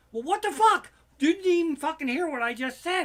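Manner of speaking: loud, high furious yell; furious outburst. Real, not acted. Yelling in outrage at a clueless fan question. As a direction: explode at the fan's question.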